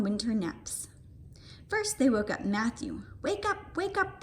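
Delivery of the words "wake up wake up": calling to wake. A narrator's reading. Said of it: "they shouted".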